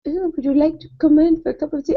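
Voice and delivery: hammed-up British accent invitation. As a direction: imitate a polite British guy. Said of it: "say in a British accent".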